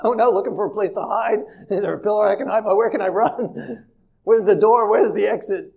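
hammed-up panic, looking for an exit; mock panic. Brothers backing away from Joseph in a hammed-up panic. Brothers seek a place to hide.

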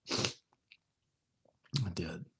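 a confiding whisper. Real, not acted. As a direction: breathe I did like a secret. Confessing childhood dream aside.

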